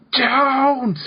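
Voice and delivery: very high, drawn-out mock scream; imitated blood-curdling scream. Friend retelling a scream heard late at night, imitated scream. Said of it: "this blood-curdling scream that's like".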